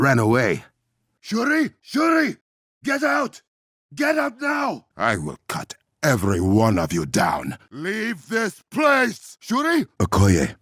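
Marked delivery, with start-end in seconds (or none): 1.2-4.9 desperate shout. Acted. Sister in danger, desperate. 7.7-9.9 desperate shout. Acted.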